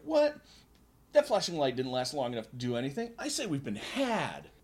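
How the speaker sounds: indignant outburst. Acted. Jack's indignant complaint.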